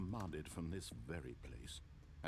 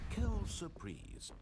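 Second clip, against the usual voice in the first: high, slow French phrase; dry French aside; 10 semitones higher than usual. Acted. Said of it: "What a surprise in French".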